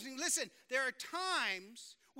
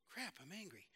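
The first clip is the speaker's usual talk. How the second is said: muttered admission; 6 semitones lower, 13 dB quieter than usual. Retold or imitated. Pastor admits anger to friend.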